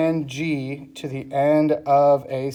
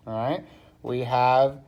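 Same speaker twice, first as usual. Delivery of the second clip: ordinary talk, nothing marked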